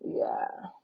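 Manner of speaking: ordinary talk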